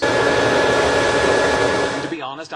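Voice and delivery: big audience laugh; crowd laughter. Real, not acted. Laughter over line.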